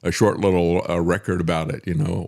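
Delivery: ordinary talk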